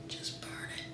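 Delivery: high breathy whisper. Real, not acted. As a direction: whisper it to yourself. Whispered aside while burning hair.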